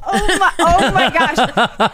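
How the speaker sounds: cracking up at the mean grandma story; laughing exclamation. Real, not acted. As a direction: burst out laughing in disbelief. Reacting to the bitchy grandma story.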